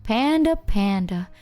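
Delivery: slow teasing commentary on a nickname; taunting. Retold or imitated. Friend trolls Panda nonstop all round, slow taunting. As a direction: needle him lazily with his name, drawn out. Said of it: "all I hear is just".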